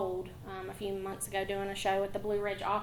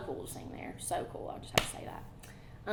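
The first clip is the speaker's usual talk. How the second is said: whispered aside; 4 semitones lower, 8 dB quieter than usual. Real, not acted. Aside about singing there.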